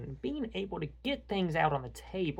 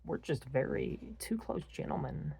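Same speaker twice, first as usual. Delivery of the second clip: ordinary delivery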